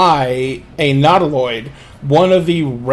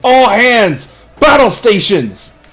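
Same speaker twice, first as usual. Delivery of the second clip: loud, raised battle-stations call; captain's yell; 5 semitones higher, 5 dB louder, slower than usual. Acted. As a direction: yell it out to the whole crew. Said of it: "looks out and he yells".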